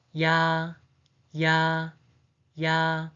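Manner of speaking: "ya" with a quick y-glide into a long, level ah; separate, drawn-out "ya" for learners to copy; vowel demo. Shown as a demonstration. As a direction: say "ya" evenly, holding the vowel on one pitch. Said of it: "The pronunciation is".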